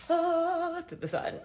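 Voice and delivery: high, excited little voice; body voice. Pretend. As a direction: squeal at the sun. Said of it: "New York body is like".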